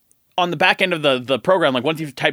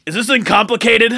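gruff voice; 4 semitones higher, 4 dB louder than usual. Retold or imitated. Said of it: "in the gruffest voice".

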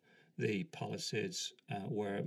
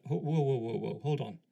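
protesting interruption; 3 semitones higher, 5 dB louder than usual. Retold or imitated. Narrator halts the sergeant's scolding.

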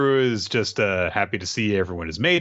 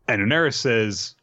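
ordinary talk, nothing marked

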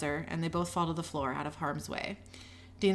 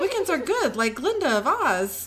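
high, louder earnest defence; naive insistence; 6 semitones higher, 6 dB louder than usual. A narrator's reading. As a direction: insist brightly on their goodness, pitched high. Becky naively defends Guy.

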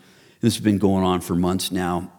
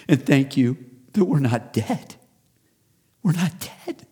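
Noah's grateful prayer; 5 semitones higher than usual. Acted. Noah praying at the altar.

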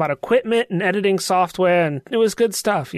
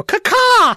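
loud, high crow caw cawed down the hallway; loud bird call; 7 dB louder than usual. Acted. Signalling the team down the hallway, loud high crow caw. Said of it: "really loudly down the hallway go".

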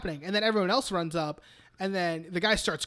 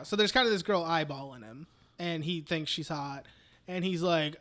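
ordinary talk

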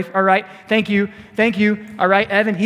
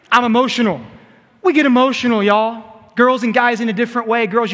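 normal talking voice

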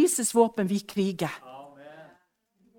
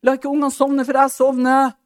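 louder, panicky cry from under the duvet; frightened calling out; 3 semitones higher, 7 dB louder than usual. Retold or imitated. Scared mother calling from under the duvet, loud and panicky. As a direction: call out as if terrified of the dark. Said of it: "Jeg ropte".

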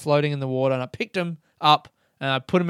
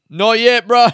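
called out; 8 semitones higher, 10 dB louder than usual. Retold or imitated. Jokey reply to stranger.

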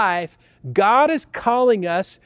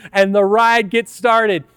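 ordinary talk

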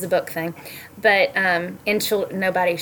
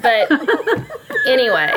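ordinary delivery

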